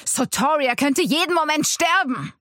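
high-pitched, exasperated alarm; urgent shout. A narrator's reading. Man pleading with a frenzied attacker, urgent shout. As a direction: shout to get through to him.

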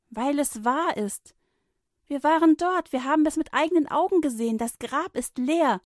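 high, loud and fast; excited insisting. A narrator's reading. Women insisting to Petrus, excited and fast. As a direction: pour it out, convinced.

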